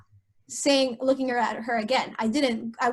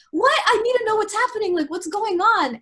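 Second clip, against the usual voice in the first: high, rushed retold protest; alarmed demanding; 8 semitones higher, 3 dB louder than usual. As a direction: demand answers urgently while being held back. Retelling panicked protest while being restrained.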